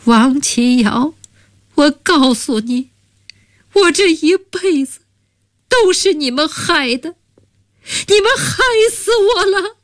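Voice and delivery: a broken woman blaming her friends, in tears; weeping accusation. Acted. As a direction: sob out the blame, pitch soaring. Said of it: "泪如泉涌地说道".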